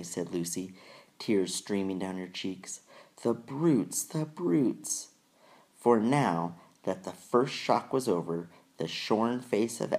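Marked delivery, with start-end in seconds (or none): 3.2-5.1 put-on high tearful girl voice; a tearful young girl, outraged. A narrator's reading. Lucy weeping in distress.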